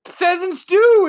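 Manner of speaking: high, loud heated exclamation; excited shout. Real, not acted. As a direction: shout it, fired up. Heated Pokémon argument.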